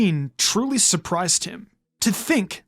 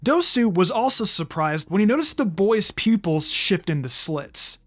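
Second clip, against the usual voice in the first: ordinary delivery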